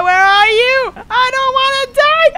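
rising, panicked high shout; scared screaming. Real, not acted. Player hunted in horror game, panicked screaming. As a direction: build from a cry into a scream. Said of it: "I scream like a fucking pussy".

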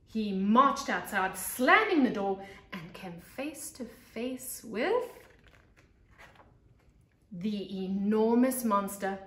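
put-on lively read-aloud voice for young kids; storybook narration. A narrator's reading. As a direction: tell it like a teacher to her class.

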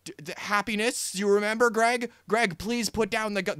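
mock-frantic puppet pleading, rising to a near yell; puppet begging. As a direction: beg him harder and harder to put the gun down. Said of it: "starts literally begging him to calm down".

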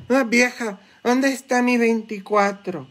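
high mock drunk calling to his wife; imitating a drunk husband. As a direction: whine for the beer like a boozer. Borracho imitado pidiendo su cerveza. (imitando a un borracho con voz aguda que le reclama a su vieja)

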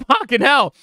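loud frustrated high yelling. Real, not acted. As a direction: yell in frustration at your slip. Frustrated after a game slip.

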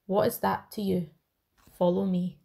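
normal talking voice

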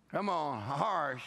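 usual speaking voice

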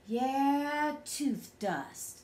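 a hint of a pondering father, trailing down; lightly voiced musing dad. A narrator's reading. Dada mulls over tooth dust, musing.

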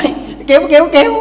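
high, loud repeated insistence; wife refusing to back down before her husband, defiantly. Acted. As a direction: keep hammering it out defiantly.

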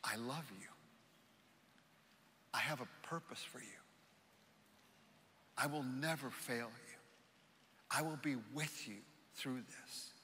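very soft, hushed words with long pauses; gentle near-whisper. Acted. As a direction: murmur it tenderly, as Jesus reassuring a listener. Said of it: "To hear him whisper".

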